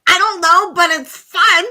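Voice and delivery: re-enacted burst of fun; giddy reply. Acts out her giddy reply.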